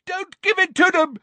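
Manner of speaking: loud, very high desperate roar; high-pitched, full-voiced plea. A narrator's reading. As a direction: yell it out at the top of the voice. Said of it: "roared Neville".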